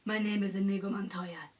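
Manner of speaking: quiet, hushed movie quote; Inigo Montoya line. Retold or imitated. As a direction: breathe the line like a fan. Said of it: "the famous lines of".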